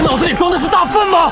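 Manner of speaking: screaming in outrage; furious shout. Acted. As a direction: yell with contempt. Furious at absurd order.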